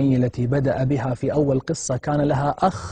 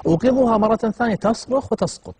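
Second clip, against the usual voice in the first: urgent retelling; 9 semitones higher, 4 dB louder than usual. A narrator's reading. Panicked retelling of mother fainting.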